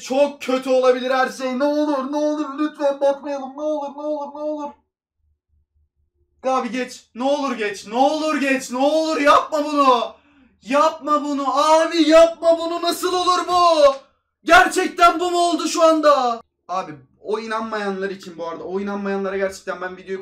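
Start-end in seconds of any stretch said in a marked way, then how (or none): 0.0-4.7 higher, louder, begging the wheel to miss; real nervous begging, voice pitched up; desperate pleading. Real, not acted. Begging the wheel not to hit. 6.4-16.4 much higher and louder, yelling in disbelief; real despairing shout, building to a cry; anguished shouting. Real, not acted. Wheel lands on bad player.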